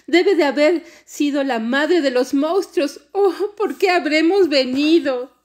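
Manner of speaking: high, loud distressed wail; shouting. A narrator's reading. Smallest girl blaming the mother of monsters, shouting. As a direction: wail with regret, slowing at the end. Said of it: "dijo gritando".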